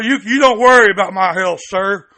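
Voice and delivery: ordinary talk, nothing marked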